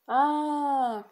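sharp high yelp of pain; a sudden cry from being shoved; a girl cries out as she is pushed over; cry as she falls. Acted. Antolka pushed, falling.

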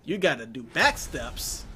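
ordinary talk

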